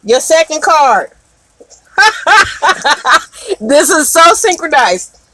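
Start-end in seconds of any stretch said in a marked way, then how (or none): 2.0-3.2 bright burst of real laughter; delighted laugh. Real, not acted. Reader laughing at a synchronized card, real delighted laugh.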